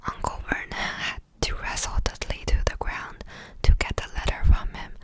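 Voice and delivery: ordinary delivery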